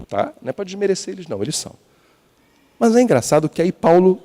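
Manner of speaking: normal talking voice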